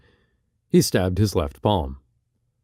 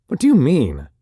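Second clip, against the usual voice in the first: higher, pleading question; desperate question; 5 semitones higher, 4 dB louder than usual. Acted. Barker at a loss before Oberon, desperate. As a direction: ask it at a loss, rising. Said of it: "asked Barker desperately".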